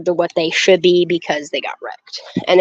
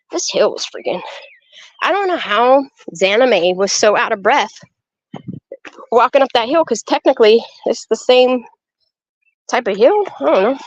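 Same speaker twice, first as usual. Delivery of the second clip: usual speaking voice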